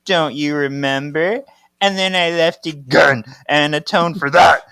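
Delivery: acted indignant rant, punching key words; angry accusation. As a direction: hurl it as a mounting reproach. Mother's angry accusation, read aloud.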